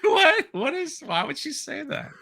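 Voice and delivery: ordinary talk, nothing marked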